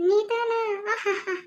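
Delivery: scornful high question breaking into laughter; mocking laugh. Turning down a marriage proposal, mocking laugh. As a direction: laugh off the very idea.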